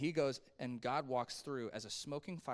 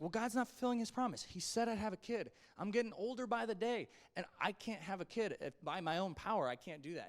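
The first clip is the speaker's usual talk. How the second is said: higher-pitched frustrated complaint; 6 semitones higher than usual. Acted. Voicing Abraham's complaint about waiting for a child, frustrated. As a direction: voice mounting exasperation, easing at the end. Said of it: "it's probably really frustrating".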